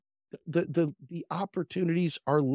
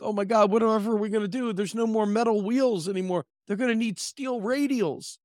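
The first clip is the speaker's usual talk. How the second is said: higher, rushed mock panic; panicked wheel maker; 9 semitones higher than usual. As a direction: ham up a fretting old craftsman. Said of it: "He's like".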